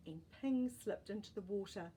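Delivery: usual speaking voice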